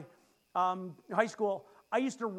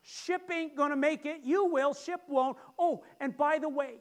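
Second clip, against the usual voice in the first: high, loud casual warning; God's heads-up; 6 semitones higher, 3 dB louder than usual. Retold or imitated. God warning Paul of the shipwreck, high casual tone. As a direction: tip them off like a friend. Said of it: "you show up and you say".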